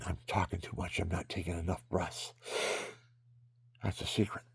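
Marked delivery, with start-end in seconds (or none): none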